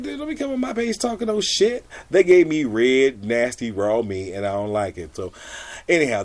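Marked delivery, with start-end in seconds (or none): 0.0-1.8 sassy scolding. Retold or imitated. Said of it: "and I'm like".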